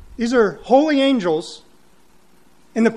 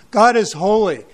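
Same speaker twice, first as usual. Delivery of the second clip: ordinary delivery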